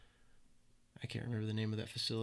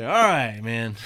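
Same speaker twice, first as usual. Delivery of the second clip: much louder, breaking into laughter; laughing startled reply; 13 dB louder, much slower than usual. Retold or imitated. Recruit answering the yelling instructor, retold loudly through laughter. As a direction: blurt it loudly while cracking up.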